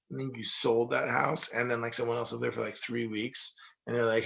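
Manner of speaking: normal talking voice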